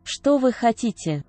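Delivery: ordinary talk, nothing marked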